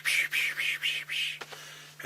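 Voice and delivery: unvoiced psh of each blow, fading away; punch sound effects. Acting out Tracy Smothers throwing punches, mock punch sounds.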